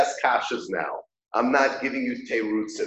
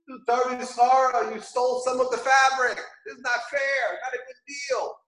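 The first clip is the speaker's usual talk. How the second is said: high protesting voice; 10 semitones higher than usual. Retold or imitated. Bas Kol protesting to Hashem.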